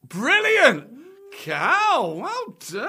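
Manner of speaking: high, loud cheer; excited praise. Real, not acted. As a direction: celebrate a right answer with gusto. Celebrates her correct guess.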